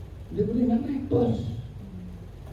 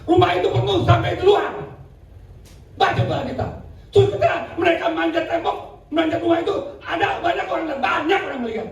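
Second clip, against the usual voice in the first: fiery preaching; 9 semitones higher than usual. Acted. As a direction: preach it out with rising fervor. Preaching at full force.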